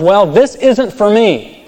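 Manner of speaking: high, slow whiny dismissal; preacher mocking a quitter, high and slow. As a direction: whine it like someone giving up. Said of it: "mocking quitter attitude".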